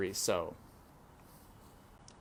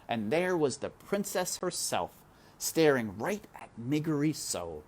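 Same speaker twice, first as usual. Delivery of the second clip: ordinary delivery, nothing marked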